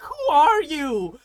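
long, high, very loud mock challenge; retold shouted comeback. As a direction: stretch the shout out, laughing. Acted shouted comeback repeated, laughing.

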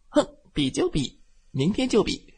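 high, huffy challenge; defiant huff. Acted. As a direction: snort and take the dare. Truck accepts the challenge. (不服气地哼)

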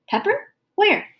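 put-on high-pitched childish questioning; surprised child. A narrator's reading. Daughter stops sweeping to look for pepper, surprised. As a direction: pipe up, startled and curious.

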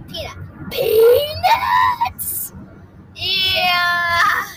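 high, drawn-out gleeful yell; playful shout. Pretend. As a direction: holler it long and high like a battle cry. Acts out gleeful peanut cry.

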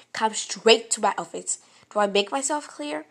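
loud, sharp command; stern loud warning. Retold or imitated. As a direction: order it firmly and loudly. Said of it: "the principal was like".

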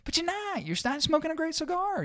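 very high, soft put-down; hushed, squeaky scorn; mock pity. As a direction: puncture the brag in a small falsetto. Scornful reply to the imagined snob.

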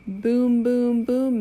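loud booming thunder. Pretend. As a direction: boom it out like thunder. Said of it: "Mister Brown makes thunder".

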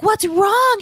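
alarmed agents. Retold or imitated. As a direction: ask in a high fretful voice.

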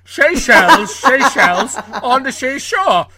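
loud laughing mock Connery, sh sounds. Shown as a demonstration. Host mocks guest's attempt, loudly laughing. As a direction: ham up the sh on "Seychelles". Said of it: "that sounded like Michael Caine".

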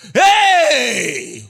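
sudden loud high cry of Hey; anguished shout. Acted. Preacher voicing Peter hitting the ground, anguished shout. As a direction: yell it as he falls.

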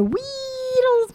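"wheedles" held long on a high note; high sustained slide through "wheedles"; playful drawn-out word. Acted. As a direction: stretch the "whee" of "wheedles" up high. Acts out an idea creeping forward.

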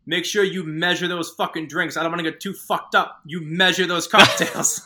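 lightly voiced stern customer order; imitating Pesci. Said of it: "looks at my friend and he's like".

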